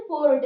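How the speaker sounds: पोर्ट said with its r sounded; teacher models पोर्ट, r clearly audible; r pronounced. Shown as a demonstration. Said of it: "इसमें आर का उच्चारण आ जाता है".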